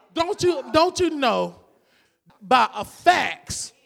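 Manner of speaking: normal talking voice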